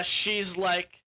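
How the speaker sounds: ordinary talk, nothing marked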